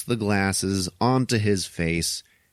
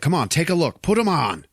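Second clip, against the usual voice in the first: raised, higher-pitched insistence; acted urging; 9 semitones higher, 4 dB louder than usual. Rowdy pressing Keith David to take the glasses, acted urging. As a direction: push it at him impatiently.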